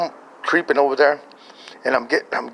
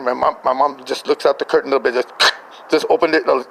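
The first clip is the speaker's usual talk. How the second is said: ordinary talk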